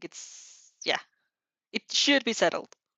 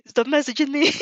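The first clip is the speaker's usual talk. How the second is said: louder and higher, half laughing in exasperation; a real laughing outburst at the phone; exasperated laughing plea; 7 semitones higher, 8 dB louder than usual. Real, not acted. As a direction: beg the messages to stop, giggling. Laughing at flood of messages.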